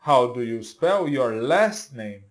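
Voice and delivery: slow question with last name stressed. Shown as a demonstration. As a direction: lean on last for the learners. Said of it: "Então, olha só". (ênfase em last, devagar)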